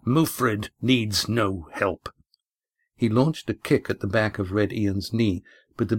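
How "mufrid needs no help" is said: slow, haughty refusal of help; proud captain. Acted. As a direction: wave them off with pride. Mufrid waves sailors away.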